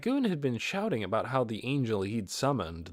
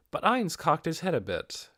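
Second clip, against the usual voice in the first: plain reading voice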